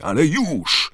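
high, loud impatient yell; Harry shouting. A narrator's reading. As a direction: shout the order at full pitch. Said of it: "krzyknął Harry". (wysoki, głośny krzyk)

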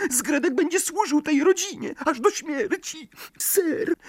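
very high, whimpering elf; a frightened servant pleading; tearful house-elf. A narrator's reading. Dobby wails. (płaczliwy skrzat)

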